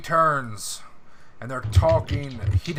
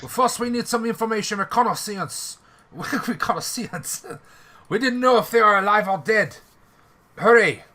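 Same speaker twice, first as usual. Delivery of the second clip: French officer voice; 6 semitones higher, 3 dB louder than usual. Acted. NPC orders reconnaissance.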